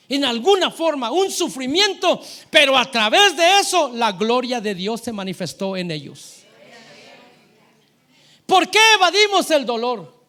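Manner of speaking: high, loud, impassioned preacher; full-force preaching. Real, not acted.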